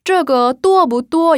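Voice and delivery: 这个多不多 slow and high, tones clearly marked; 这个多不多 syllable by syllable; Mandarin model sentence. Shown as a demonstration. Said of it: "많다란 뜻이에요".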